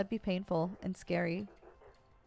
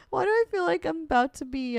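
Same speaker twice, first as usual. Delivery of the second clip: laughing; 7 semitones higher, 6 dB louder than usual. Real, not acted. Laughing at the raccoon seller.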